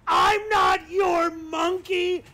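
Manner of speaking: high, drawn-out loud scream. Retold or imitated. Drunk comedian screaming back at a fan, high slow yell. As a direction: scream it slowly at the pestering fan. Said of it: "and you screamed".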